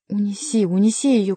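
urgent call, rising in loudness; mild shout, pressing the plea; shouted order. A narrator's reading. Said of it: "крикнул Бюсси". (крикнуть с настойчивостью)

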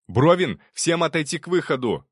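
commanding shout. Acted. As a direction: bark the retreat order over the noise. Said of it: "скомандовал я".

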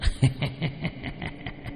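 breathy, rising ghostly chuckle; a dry rattling laugh from the dark; dry eerie laugh. Acted. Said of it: "một âm thanh khô khốc vang lên".

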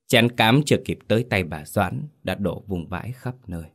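ordinary delivery, nothing marked

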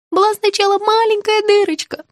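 very high, small, plaintive; meek little voice. Acted. Patient confessing to a cavity, meek. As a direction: explain it in a tiny high voice. Patient meekly explains her toothache.

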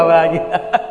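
normal talking voice

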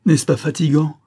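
put-on fed-up young nurse complaining; exasperated outcry. A narrator's reading. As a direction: cry out in mild despair, wanting a witness. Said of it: "s'écria la garde-malade en prenant dans son désespoir".

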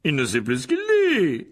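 loud, high, drawn-out proclamation; trumpeting voice. A narrator's reading. Lockhart to the anxious Gryffindor pupils, trumpeting voice. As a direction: trumpet it out to the crowd. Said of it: "lança Lockhart d'une voix claironnante".

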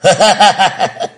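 high, loud gleeful cackle; gleeful laugh. A narrator's reading. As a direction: cackle with delight. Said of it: "mừng rỡ kêu lên".

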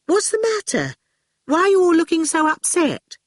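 put-on high-pitched, puzzled girl; Fifi's voice. A narrator's reading. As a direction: ask innocently, pitched well up. Fifi voiced, puzzled by row.

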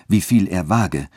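plain reading voice

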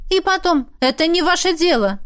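sharp, high burst of temper; flaring up. A narrator's reading. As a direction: snap it out, suddenly hot. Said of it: "вспылила я". (вспылив, резко и высоко)

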